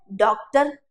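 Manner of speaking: डॉक्टर with an open rounded 'aw' vowel; English pronunciation. Shown as a demonstration. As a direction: say डॉक्टर as in English, 'DAWK-tar'. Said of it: "अंग्रेजी में इसको क्या बुलाता है".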